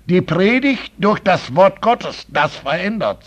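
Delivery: loud, slow, fervent pulpit preaching; preaching at full force. Acted. Preacher urging the church to give real bread, loud fervent preaching. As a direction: thunder it from the pulpit to the congregation.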